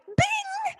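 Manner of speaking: high sung bing like an airplane chime; ping it like the seatbelt bell; chime imitation. Mimicking the intercom chime waking her up.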